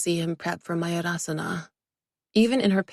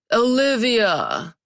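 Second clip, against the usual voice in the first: slow, louder exasperated call of her name; 4 semitones higher, 5 dB louder, much slower than usual. Acted. Nate calls his assistant, exasperated. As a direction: drag her name out in annoyance. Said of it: "he said, exasperated".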